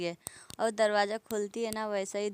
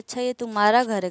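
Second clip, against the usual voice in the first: angry retort; 2 semitones higher, 8 dB louder than usual. Retold or imitated. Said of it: "गुस्सा हो रही होती है".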